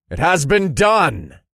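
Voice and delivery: high, loud proclamation; loud, drawn-out announcement; called out. A narrator's reading. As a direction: call it out triumphantly to a whole room, stretching the words. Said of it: "I called out".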